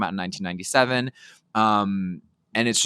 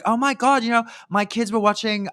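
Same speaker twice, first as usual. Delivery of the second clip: higher, half-hearted attempt at an Australian accent; a weak stab at an Aussie accent, pitched up; Nicole's Aussie accent; 12 semitones higher than usual. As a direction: lightly imitate an Australian actress gushing. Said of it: "I can't even do the Aussie accent".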